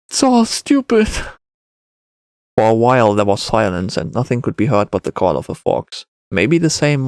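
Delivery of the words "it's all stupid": put-on high-pitched boy giving up; resigned child. A narrator's reading. Boy gives up insulting, resigned high child voice. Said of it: "it lowered its head in resignation".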